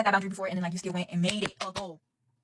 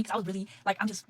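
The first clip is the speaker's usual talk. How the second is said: ordinary talk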